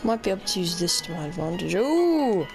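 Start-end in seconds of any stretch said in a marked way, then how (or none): none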